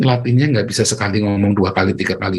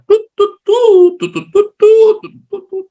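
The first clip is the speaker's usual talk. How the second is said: high-pitched staccato toots; comic goat farts in Morse-code rhythm; imitated fart noises; 6 dB louder, slower than usual. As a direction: toot out short and long squeaky farts. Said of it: "coba kentutnya berapa panjang".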